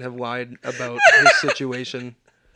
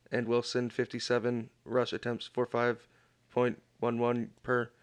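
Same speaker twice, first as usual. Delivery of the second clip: softer, deadpan read of a fan post; reading a post aloud; 5 dB quieter than usual. Acted. Reading a stranger's Facebook rant to the hosts, deadpan. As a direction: read it straight, no commentary.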